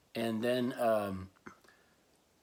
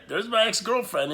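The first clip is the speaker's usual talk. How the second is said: higher and louder, stressing "my"; stunned emphasis; 10 semitones higher, 8 dB louder than usual. Real, not acted. Telling viewers his ex was calling, louder in disbelief. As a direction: hit it again in disbelief.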